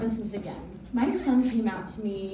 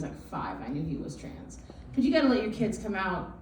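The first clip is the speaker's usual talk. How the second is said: ordinary delivery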